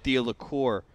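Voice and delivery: usual speaking voice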